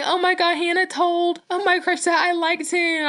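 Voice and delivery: high wailing retold cry, voice pushed up; a hammed-up teary wail; mock sobbing. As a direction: replay the tearful panic to mom, pitched high. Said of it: "I'm, like, sobbing and crying".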